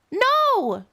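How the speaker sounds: high mock shout. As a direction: cry out No like a fleeing cat. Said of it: "shitted Star".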